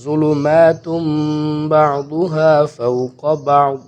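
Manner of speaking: slow melodic chant; Quran recitation. Shown as a demonstration. Teacher recites the next phrase for students, slow chant. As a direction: recite بعضها فوق بعض slowly. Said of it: "اب یہ ظلمات".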